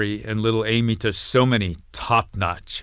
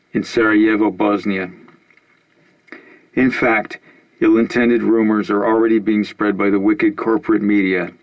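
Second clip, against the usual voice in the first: louder formal statement read; formal announcement; 5 dB louder than usual. Acted. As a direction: declare it like a press statement. Reads coalition announcement on TV.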